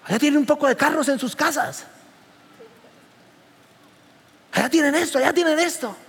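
a hint of the critical taxi lady listing things; taxi lady's complaint.

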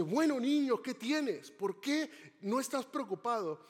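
put-on terrified passenger pleading with a calm boy; desperate woman. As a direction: beg the boy like the plane is falling. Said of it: "la señora desesperada le dice".

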